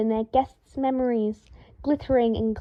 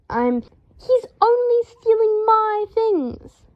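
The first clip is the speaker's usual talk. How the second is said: slow, much higher, louder frantic yell; hysterical yell; 11 semitones higher, 4 dB louder, much slower than usual. A narrator's reading. Draco yells hysterically at Harry about the ferret. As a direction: wail it in hysterics. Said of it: "Draco yelled hysterically".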